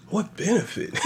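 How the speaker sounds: high, half-laughing disbelief; high scoffing question; incredulous. Real, not acted. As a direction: ask it like it's absurd. Mocking the pointless trash talk.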